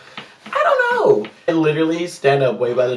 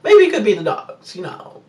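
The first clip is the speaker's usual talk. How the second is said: usual speaking voice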